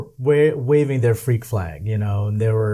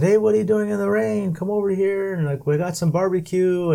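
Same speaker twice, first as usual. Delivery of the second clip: friendly party invite; 7 semitones higher than usual. Retold or imitated. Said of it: "and they said".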